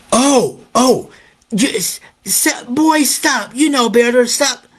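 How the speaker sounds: a startled teacher scrambling to scold; flustered teacher. Retold or imitated. Teacher suddenly scolds the bullying boy, high and flustered.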